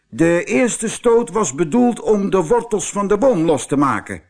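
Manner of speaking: high, loud, quick bluffing bull; excuse-making bull. Acted. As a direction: talk your way out as the proud bull.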